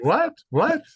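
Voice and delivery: high, loud incredulous What, What; baffled what. Retold or imitated. Doctor imitating confused people reacting, high and loud. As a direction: mimic people blurting out in disbelief. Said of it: "people always say".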